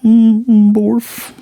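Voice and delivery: high, muffled dog woof. Retold or imitated. Bond the dog answers Lloyd's warning, high imitated woof. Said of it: "just Bond's like".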